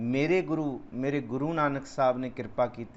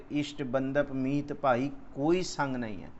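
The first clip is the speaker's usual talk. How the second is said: ordinary talk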